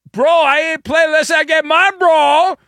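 high, loud bro-speak outburst; mock player demand. Host mocking a player's demand, high mocking shout.

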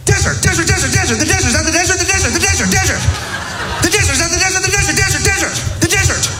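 very high, rapid repeated shouting; frantic guessers. Retold or imitated. People guessing the drawing, very high frantic repetition. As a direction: babble it over and over like a room of guessers. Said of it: "then people say".

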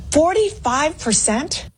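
"forty-five percent?" asked slowly, pitch rising high in disbelief; incredulous. Real, not acted. Incredulous echo of poll number.

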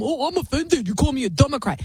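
high, mocking offended voice; mock offended whine. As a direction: sneer out a whiny fake outrage.

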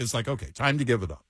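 ordinary delivery

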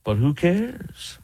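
slow, high, sneering "who cares"; slow, high mocking imitation of "But who cares?"; mocking drawl. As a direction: mimic him with a sneer, drawn out. Mocking repeat of quote.

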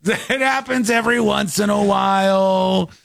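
high, laughing impression of the AI actress; high mock robot voice, laughing. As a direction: play the glitching robot apologizing. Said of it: "Oh, my apologies".

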